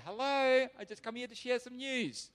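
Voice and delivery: a chirpy stranger at the door; mock visitor greeting guards; cheery visitor. Imagined knocking on prison door.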